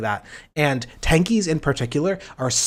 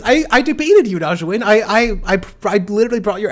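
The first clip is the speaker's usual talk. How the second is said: indignant outburst; 6 semitones higher, 4 dB louder than usual. Real, not acted. Protesting to Najwin.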